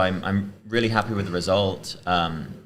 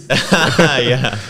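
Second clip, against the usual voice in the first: laughing yeah; 5 semitones higher, 10 dB louder than usual. Real, not acted. Laughing after the win surprise.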